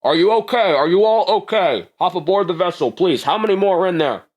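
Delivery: a rescue medic calling to survivors; urgent paramedic. Acted. Paramedic hurrying survivors aboard the dropship, urgent and loud. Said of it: "seems to be a paramedic and goes".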